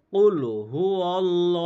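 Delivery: slow chanted কুল হুয়াল্লাহু with heavy ল্লা; Quran recitation. Shown as a demonstration. Teacher showing students the recited verse slowly. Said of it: "তো কীভাবে পড়বেন?".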